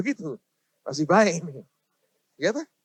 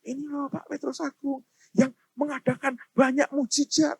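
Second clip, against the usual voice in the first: lightly voiced host, higher pitch; imitating introducer; 6 semitones higher than usual. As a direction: mimic someone introducing a guest, raised pitch. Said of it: "Ngomongnya, ngomongnya gimana?". (menirukan orang memperkenalkan tamu, nada agak tinggi)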